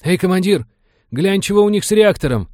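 high, loud, urgent hail; alarmed call. A narrator's reading. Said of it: "встревоженно окликнул черепа пилот".

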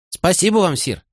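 put-on high, polite child's thanks; lightly voiced little girl. A narrator's reading.

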